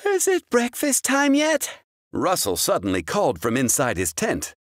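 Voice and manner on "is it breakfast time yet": put-on high sleepy boy calling; child voice. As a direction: call out like a hungry kid. Boy calls from tent.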